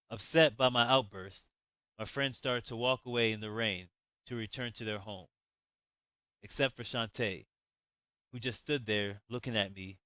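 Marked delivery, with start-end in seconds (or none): none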